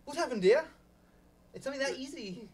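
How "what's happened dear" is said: British husband. Shown as a demonstration. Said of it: "We're doing English in this play".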